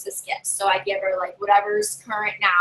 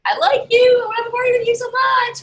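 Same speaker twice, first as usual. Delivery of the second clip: gushing; 12 semitones higher, 5 dB louder than usual. Retold or imitated. Gushing over favorite guests.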